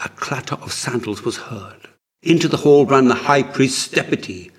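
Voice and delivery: usual speaking voice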